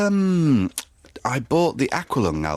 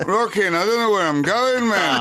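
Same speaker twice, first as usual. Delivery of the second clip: higher, louder rambling rocker voice; 10 semitones higher, 4 dB louder than usual. Retold or imitated. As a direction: drift off like a dazed old rock star.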